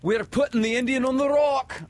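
high, loud shout across the water; Smee calling out. Acted. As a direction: hail the captain from the boat. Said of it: "Smee called out".

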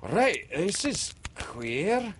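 high, slow bewildered pirate; puzzled under his breath; Smee gasped. Acted. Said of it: "Smee gasped".

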